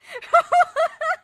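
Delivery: high, very loud burst of real laughter; loud laughing fit. Real, not acted. Player cracking up at the game, real high loud laughter. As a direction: crack up helplessly at full volume.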